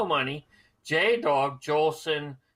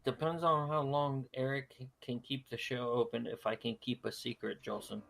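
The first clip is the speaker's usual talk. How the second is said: low, soft, sultry purr; sexy voice; 6 semitones lower, 6 dB quieter than usual. Real, not acted. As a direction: drop into a seductive murmur. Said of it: "talking in your sexy voice".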